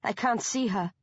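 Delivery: usual speaking voice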